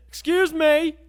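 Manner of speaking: high, loud shout for attention. Acted. Desperate customer to counter girl facing away, shouting. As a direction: shout it at the back of someone's head. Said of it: "I basically shouted at the back of this poor girl's head".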